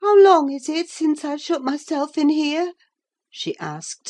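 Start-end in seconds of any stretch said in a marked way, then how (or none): 0.0-2.7 high, louder, alert question; suddenly reviving. A narrator's reading. Catherine reviving to ask Nelly, high and alert. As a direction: snap awake and ask. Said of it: "suddenly reviving".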